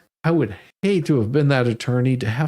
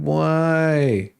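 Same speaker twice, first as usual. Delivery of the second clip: put-on annoying toddler. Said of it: "he had this most annoying voice".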